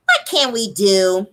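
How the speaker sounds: slow drawn-out imitation of Melody; Melody's voice. Host voicing Melody's question to the group, slow and drawn out. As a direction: say it slowly like Melody. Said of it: "let me say it in her voice".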